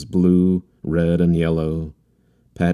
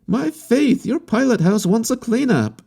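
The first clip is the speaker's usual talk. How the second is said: much higher voice, lively and chatty; bubbling with energy; vivacious. A narrator's reading. Harlequin chattering to the captain, high and vivacious. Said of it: "Then vivaciously".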